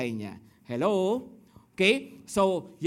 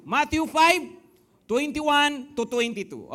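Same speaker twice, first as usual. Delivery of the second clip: loud repeated verse; 5 semitones higher, 5 dB louder, slower than usual. Real, not acted.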